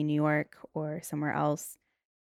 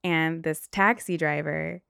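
ordinary talk

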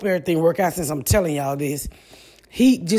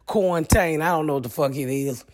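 ordinary talk, nothing marked

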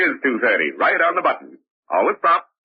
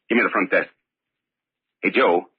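ordinary talk

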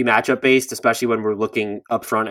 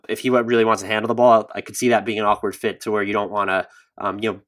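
usual speaking voice

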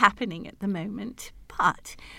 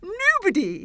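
high, louder drawn-out word; happy king; 7 semitones higher, 4 dB louder than usual. Acted. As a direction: sing out the word grandly. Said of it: "as he kissed her tenderly".

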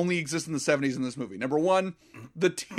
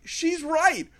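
high, breezy agreement; mock movie voice; 12 semitones higher than usual. The movie agreeing with the teacher, high and breezy. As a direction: play it off like the film does. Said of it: "the movie just kinda plays it off like".

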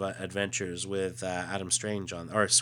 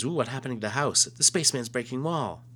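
put-on childlike voice; 5 semitones higher, 3 dB louder than usual. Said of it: "He says".

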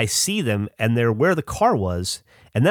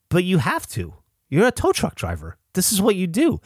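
higher-pitched naive protest, replayed; retold insistent plea; 8 semitones higher than usual. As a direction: plead lightly, as a teenager insisting. Retold teen pleading with driver.

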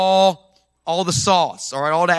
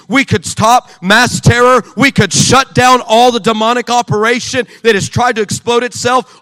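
loud, slow hammered declarations; preaching at full force; 4 semitones higher, 8 dB louder than usual. Acted. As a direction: preach each line hard and slow.

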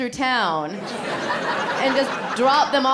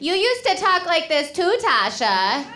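put-on higher, broad Illinois mom accent; 5 semitones higher than usual. Mom teasing comedian about her old Illinois accent. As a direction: imitate a mom's thick Illinois accent. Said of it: "And my mom's like".

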